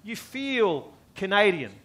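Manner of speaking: usual speaking voice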